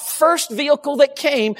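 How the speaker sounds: ordinary talk, nothing marked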